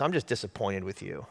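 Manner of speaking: low, soft reproach; disappointed dad. Retold or imitated. Dad reproaching his son on arriving home, disappointed. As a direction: drop the voice in quiet disappointment.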